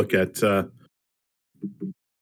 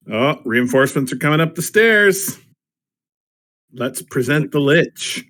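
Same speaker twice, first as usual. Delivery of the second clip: raised, higher in-character announcement; loud, higher mock warning; acted call-out; 5 semitones higher, 4 dB louder than usual. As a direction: call it out for everyone to hear. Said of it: "I'm going to just say".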